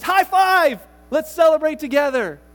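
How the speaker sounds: loud, high celebratory shout; excited cheer. Acted. Master celebrating servant.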